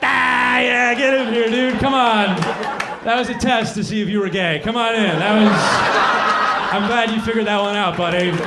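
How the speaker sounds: loud, hearty bro welcome; bro God. Retold or imitated. God welcoming the sinner to heaven, a loud hearty bro. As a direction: greet him like a buddy at a party.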